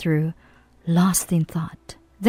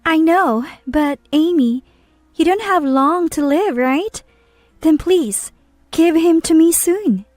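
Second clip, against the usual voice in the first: put-on higher, insistent young woman; pleading young woman; 8 semitones higher than usual. A narrator's reading. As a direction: press her demand, voice lifted high. Heated exchange, pitch far up.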